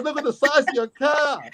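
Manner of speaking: high-pitched amazed teasing; mocking policeman. Policeman making fun of the stranger's car, mocking. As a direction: imitate a cop marvelling at a tiny car. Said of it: "this big native policeman goes".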